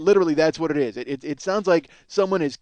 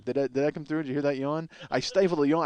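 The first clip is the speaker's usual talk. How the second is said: usual speaking voice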